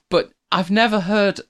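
normal talking voice

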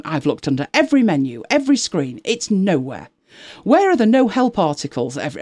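mock a customer's mounting outrage; whining complaint. Host reading a forum rant about missing settings, mock-indignant whine. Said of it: "I'm gonna need to practice the high-pitched whine".

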